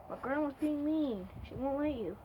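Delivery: ordinary talk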